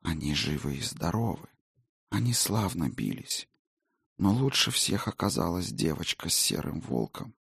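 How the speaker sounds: faint, airy ghost whisper; a weary spirit, barely there; ghostly whisper. Acted. Ghost reports.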